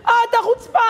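high-pitched mock outrage; scolding neighbor. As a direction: imitate an indignant trapped woman. Acted neighbor scolding him.

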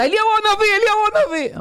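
very high, thrilled outburst; the wife jumps in, sure the guest was Elijah; excited exclamation. Retold or imitated. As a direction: blurt it out, leaping with excitement. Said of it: "אז היא קפצה".

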